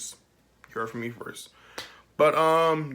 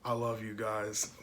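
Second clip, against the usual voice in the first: quoting with a laugh. Retold or imitated. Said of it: "Andrew Garfield acknowledged it".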